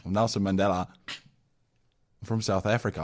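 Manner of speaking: put-on loud, angry-sounding South African accent; badly faked South African impression, too forceful; Nelson Mandela. Improvising an old statesman for co-host, angry South African accent. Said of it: "Why is he so angry?".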